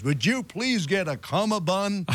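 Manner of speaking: imitating Dick.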